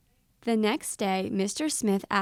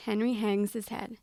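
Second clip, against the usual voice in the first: ordinary delivery, nothing marked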